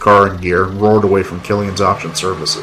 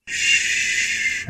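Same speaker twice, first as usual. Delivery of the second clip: soft, whispered shushing; mumbled hush; 10 dB quieter than usual. Acted. Man holding crying Ava, mumbled soothing shush. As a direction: hush her very softly. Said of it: "I mumbled".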